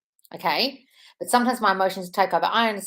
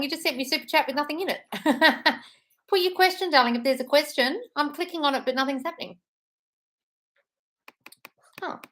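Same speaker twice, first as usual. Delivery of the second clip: usual speaking voice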